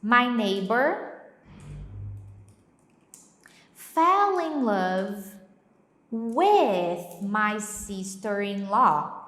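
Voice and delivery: very slow, each word clear; slow example. Shown as a demonstration. Teacher reading the example slowly for students. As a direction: spell it out slowly. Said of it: "eu posso falar assim".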